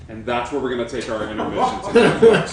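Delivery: loud, high, laughing announcement to the audience; excited intermission call. Real, not acted. Host announcing break to viewers, loud and laughing. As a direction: shout out the break through laughter.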